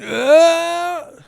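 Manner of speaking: high, loud effort grunt in a different voice; straining grunt. Retold or imitated. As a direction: grunt like you're hauling a wagon. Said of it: "remember when he was lifting the wagon".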